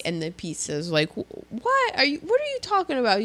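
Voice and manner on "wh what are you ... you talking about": high incredulous question, pitched up; a stunned guy who can't believe his ears; shocked disbelief. Retold or imitated. Joke teller voicing a baffled guy, incredulous.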